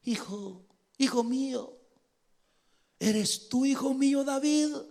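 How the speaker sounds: high, halting acted voice; hammed-up pleading father. Saul calling to his son David, hammed-up pleading. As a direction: plead with rising pitch, mock-emotional.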